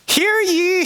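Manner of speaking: a high ringing hear ye; herald's cry. Acted. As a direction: ring it out like a town crier. Herald cries proclamation.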